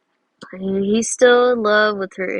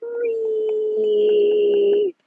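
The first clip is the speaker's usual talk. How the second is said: high, squealing little ghost voice; playful high ghost wail; silly ghost; 11 semitones higher, much slower than usual. Pretend. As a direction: lean into a squeaky ghost bit. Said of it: "let's lean into this a little bit".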